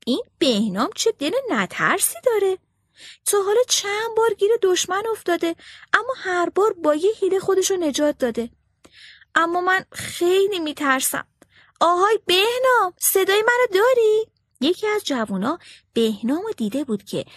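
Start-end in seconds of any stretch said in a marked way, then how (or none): 0.1-11.3 higher, admiring then fearful bird voice; myna thinking aloud. A narrator's reading. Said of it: "مرغ مینا با خودش فکر کرد". 11.8-14.3 higher, louder call across the streets. A narrator's reading. Myna calling out to Behnam.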